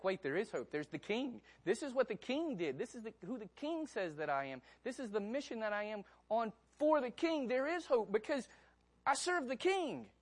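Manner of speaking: building from realisation to high, loud triumph; rising hope. Retold or imitated. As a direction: let the hope swell until it rings out.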